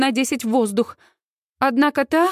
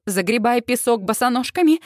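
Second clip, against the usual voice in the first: usual speaking voice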